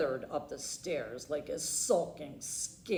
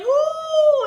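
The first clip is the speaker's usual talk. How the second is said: hyena howl; 15 dB louder than usual. Acted. Howling hyena imitation.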